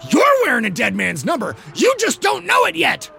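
growling shout at the rival quarterback; growled shout. Acted. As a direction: bellow the insult across the field. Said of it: "Tweedy growled".

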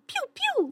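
high little cartoon pew pew; cartoon gun sound. Shown as a demonstration. Georgia demos her gun sound, high and tiny. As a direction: squeak a tiny toy gun. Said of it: "a gun sound effect".

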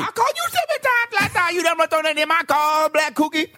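shrieky mock girlfriend; complaining woman. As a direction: nag about unreturned calls.

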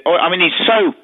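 ordinary delivery

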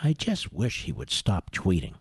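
very low, hushed mock lament; mock wishful complainer. Host mimicking critics wishing tweets away, hushed lament. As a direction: sink into a soft, feigned-weary wish.

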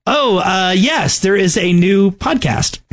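normal talking voice

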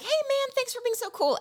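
breezy thanks. Pretend. Hypothetical cool greeting.

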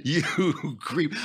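mock the line through laughter, pausing between words; laughing, hammed-up retort; mocking imitation. Mockingly replays the clip's line.

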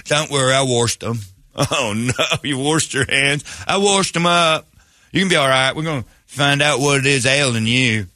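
mock-folksy hillbilly doctor; hillbilly surgeon. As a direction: reassure the patient in a hillbilly accent. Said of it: "I've got a hillbilly about to cut me".

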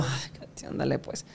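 urgent hushed whisper, voice dropped right down; whispering to his wife mid-scene. Retold or imitated. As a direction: hiss it so the crew won't hear. Hushing wife during the shoot.

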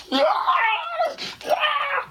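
high, loud wailing shriek, acted out; mock crying scream; imitated crying scream. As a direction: wail like a terrified kid on a ride.

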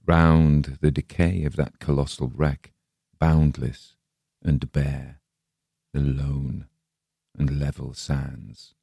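slow, solemn poetry recital; measured dramatic poem delivery; poem reading. Acted. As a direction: read it like a grave old monument speaking. Reads Ozymandias poem aloud.

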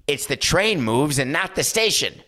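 high, emphatic comedian impression; Jon Lovitz. Host quoting a comedian's line, high-pitched impression. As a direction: pitch it up and proclaim it. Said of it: "Thank you, John Lovitz".

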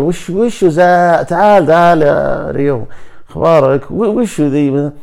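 high, loud, drawn-out puzzled questions; puzzled asking back. Retold or imitated. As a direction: stretch out each question, high and loud, like you can't make sense of it. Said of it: "أنا بعض الأحيان ما أدري حتى وش قال". (سؤال مستغرب عالي وممطوط)

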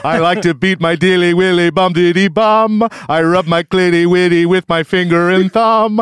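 singsong comic emcee rattling off a rhyme; hammed-up awards host patter; Mister Cum. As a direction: chant the silly rhyme like a cartoon host. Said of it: "just a little snippet of my work".

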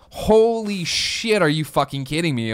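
high, slow, stunned "holy shit"; retelling a stunned reaction, voice jumping high; stunned disbelief. Retold or imitated. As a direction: blurt it out in shocked disbelief.

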